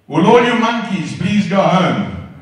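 loud, slow bellowed announcement; slow loud mock proclamation; mock shout. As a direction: yell it slowly at the crowd from a box. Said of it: "and said".

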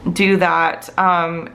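usual speaking voice

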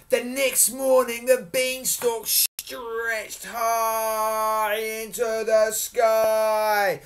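high, loud, hugely stretched words; grand storytelling. A narrator's reading. Storyteller showing children the beanstalk's height, grandly. Big dramatic narration.

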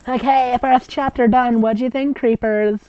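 high, perky fan-fiction author; mock author voice. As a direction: read it like a chirpy teen writer. Reading the author's note.

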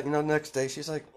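ordinary delivery, nothing marked

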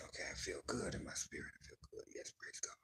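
soft whispered praise. Real, not acted. Host whispering praise to herself after a reading. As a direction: breathe the words as a prayer.

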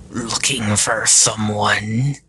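slow, brutal taunt. A narrator's reading. Creature surprises Sam from behind, brutal taunting voice. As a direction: draw it out menacingly. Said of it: "a brutal voice called from behind".

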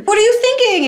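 high-pitched incredulous scolding; hammed-up exasperation; mock outrage. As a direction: squawk in acted disbelief. Acted reaction to firing Santa.

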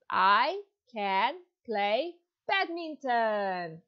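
"I can play badminton." said slowly and evenly; slow model sentence. Shown as a demonstration. Teacher to John, modelling the full sentence slowly. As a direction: spell it out word by word. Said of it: "Can you say full sentence?".

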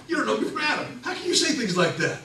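high, loud, laughing mock protest; defensive outburst. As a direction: bristle like a man caught off guard. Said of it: "Nathanael immediately becomes defensive".

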